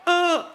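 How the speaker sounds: a high, startled little "uh" gulp; quick nervous gulp sound, imitated; nervous tick. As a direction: mimic a jittery quarterback's nervous noise. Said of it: "He gives me that little nervous little tick".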